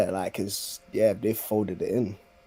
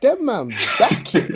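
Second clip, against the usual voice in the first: much higher, louder, slow punchy slang line; slow emphatic repeat; 11 semitones higher, 6 dB louder, slower than usual. Acted. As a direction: drag out "Them man back it" high and loud. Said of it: "What you mean?".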